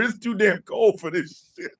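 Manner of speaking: ordinary talk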